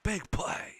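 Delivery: sudden high, loud cheer; excited yell. Real, not acted. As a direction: shout it out as the play breaks. Excited fan rewatching big play.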